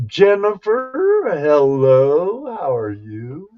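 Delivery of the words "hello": normal talking voice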